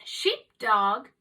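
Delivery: ordinary delivery, nothing marked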